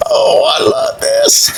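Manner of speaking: very high squeal of delight; real delight. Real, not acted. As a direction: squeal it, overjoyed at the gift. Delighted by gift while unboxing.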